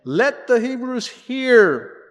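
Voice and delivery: loud, high, drawn-out herald's call; ringing royal summons, stretched out; proclamation. A narrator's reading. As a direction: call it out slowly across the land. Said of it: "blew the trumpet throughout the land, saying".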